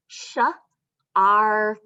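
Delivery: "Sh" then "ark" split apart; sounding out. Shown as a demonstration. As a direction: segment the word for the kids. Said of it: "Listen to the sounds".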